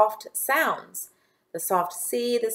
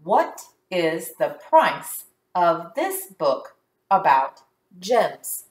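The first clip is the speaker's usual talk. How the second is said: slow, word-by-word reading with small gaps; careful, separated words; slow read-along; slower than usual. Shown as a demonstration. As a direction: read it slowly for learners to follow. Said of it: "Let's read it together".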